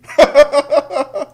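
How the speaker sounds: high, bursting laugh; hearty laugh. Real, not acted. Host mocks his own silly scenario, laughing heartily. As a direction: crack up at the absurdity.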